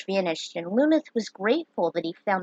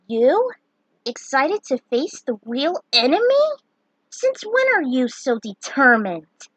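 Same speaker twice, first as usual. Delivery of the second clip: raised, incredulous; surprised teasing; 5 semitones higher, 4 dB louder than usual. Acted. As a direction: ask in amused disbelief. Friend surprised by Luneth.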